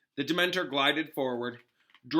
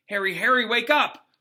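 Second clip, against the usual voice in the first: louder, higher call to wake him; worried teacher calling a fainted boy; urgent call; 9 semitones higher, 9 dB louder than usual. A narrator's reading. Lupin waking fainted Harry.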